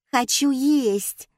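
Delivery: put-on high, drawn-out child's whine; whining. A narrator's reading. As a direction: whine at mum, stretching the words. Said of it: "заныла Катя".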